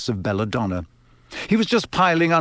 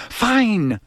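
a sharp, higher snap of a fed-up mother; snapped; 9 semitones higher, 3 dB louder than usual. Acted. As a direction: bite the word off, clearly annoyed. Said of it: "snapped Missus Weasley".

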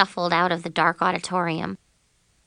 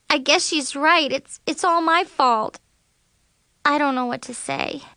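higher-pitched, halting admission of fault; upset confession; 7 semitones higher than usual. A narrator's reading. Girl taking the blame before her bandmates, upset and halting.

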